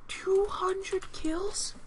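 slow, drawn-out question in disbelief; stunned disbelief. Real, not acted. Player reacts to the enemy's two hundred kills with slow disbelief. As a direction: stretch each word as if you can't believe it.

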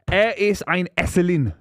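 slow, deliberate German accent. Shown as a demonstration. Friend showing off schoolboy German to mates, German accent. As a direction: put on a careful German accent. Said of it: "that's my German accent".

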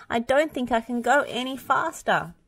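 high, strained protest; spluttering Muck. A narrator's reading. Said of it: "spluttered Muck".

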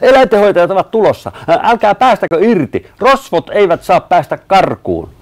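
high, loud yelling; urgent shout. A narrator's reading. Matti calls to hold the robbers, urgent shout. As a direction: yell commands at the top of the voice. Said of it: "Matti huusi".

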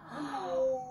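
normal talking voice

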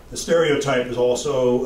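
usual speaking voice

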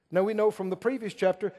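ordinary talk

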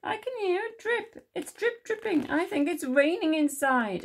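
high squeaky little-mouse voice; alarmed Little Mouse. A narrator's reading. Little Mouse hears dripping indoors, high alarmed squeak. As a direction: report it high and urgently. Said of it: "he said".

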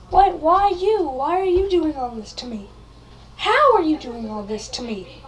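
loud, wailing mock dismay; hammed-up victim's outcry; distraught protest. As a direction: plead melodramatically with the villain. Victim confronts villain.

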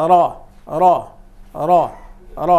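"র" as a heavy, rounded "ro"; thick ra. Shown as a demonstration. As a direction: say "র" full-mouthed with a thick r. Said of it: "এরকম মোটা করে র". ("র" মোটা করে উচ্চারণ)

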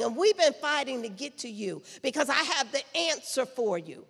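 loud, driving preacher's voice; full-force preaching. Acted.

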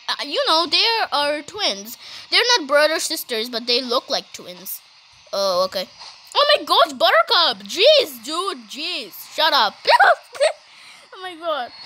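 normal talking voice

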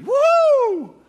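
a sarcastic party whoop; mock cheer. Preacher mocks the theologians' glee with a whoop.

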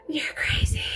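gasped-out whisper of awe. Retold or imitated. Speaker reacting to the girl, a whispered gasp. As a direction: breathe it in amazement. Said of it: "And I was just like".

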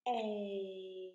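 A drawn out, gliding as it goes; long vowel A. Shown as a demonstration. Teacher shows children the long sound, A stretched out. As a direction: hold the letter name A long. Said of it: "It's a long vowel sound".

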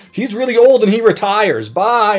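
breezy DM. Retold or imitated. DM quoted dismissing paladin NPC.

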